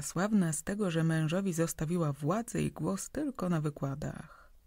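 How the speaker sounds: usual speaking voice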